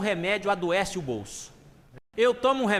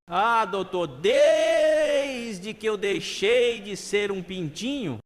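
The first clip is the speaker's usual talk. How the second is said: very slow, stretched and raised in pitch; hammed-up, long-drawn explanation; drawn-out patient reply; 3 semitones higher, 4 dB louder, much slower than usual. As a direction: drag out the key word for comic effect. Patient answers psychiatrist, words stretched.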